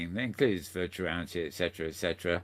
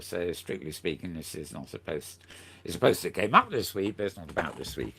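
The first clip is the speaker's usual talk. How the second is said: ordinary talk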